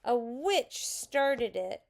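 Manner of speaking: high, slow accusing whine; wronged child's drawn-out complaint; tearful. A narrator's reading. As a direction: tattle loudly and miserably. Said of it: "said Susan tearfully".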